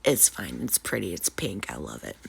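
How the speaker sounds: ordinary delivery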